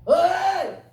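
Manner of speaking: loud, high hailing shout; sudden high shouted hail; calling out. Shown as a demonstration. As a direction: holler to get someone's attention from afar. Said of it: "그게 소리쳤다는 거예요".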